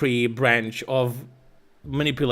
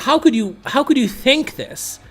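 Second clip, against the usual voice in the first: higher, exasperated disbelief; incredulous; 8 semitones higher than usual. Retold or imitated. Retelling an argument with her, higher mock-incredulous scoff. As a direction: scoff at her position as if stunned. Retold argument, quoting himself.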